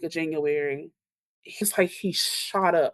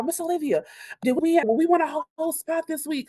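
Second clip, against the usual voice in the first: high-pitched, quick imitation of excited kids; eager kids pleading; 10 semitones higher, faster than usual. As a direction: mimic children pestering their teacher, fast and high. Retelling kids begging in the hall.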